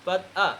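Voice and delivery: পাত আ as "faa-dha", no R; silent final R. Shown as a demonstration. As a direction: end পাত আ on an open "aa". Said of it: "কী বলতে হবে".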